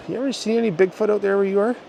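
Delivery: high, bright curious question; eager question. Retold or imitated. Asks friend about Bigfoot casually.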